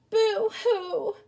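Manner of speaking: high, loud fake sob with a laugh; hammed-up boo-hoo wail; mock boo-hoo cry. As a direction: play-act a silly cry. Mock crying after cut finger.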